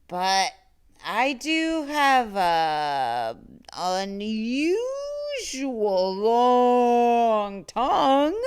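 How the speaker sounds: slow, loud, slobbery monster drawl; slurping monster. A narrator's reading. Monster Mac bragging to the child about his tongue, slurping slowly. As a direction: savor each word like drool. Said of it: "Mac slurped".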